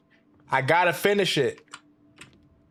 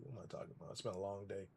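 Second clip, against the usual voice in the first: tired mumble; 5 semitones lower, 21 dB quieter, much faster than usual. Real, not acted. Confused, tired after a slip.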